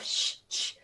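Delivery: sh, sh as bare hushing consonants; Polish hushing sounds. Shown as a demonstration. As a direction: demonstrate each sh as an isolated hiss. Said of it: "In Polish language, we have a lot of".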